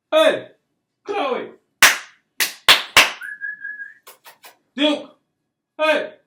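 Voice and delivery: high, loud calls across the yard; calling the dogs. Real, not acted. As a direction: shout the dogs' names to get them back. Calling the dogs mid-story.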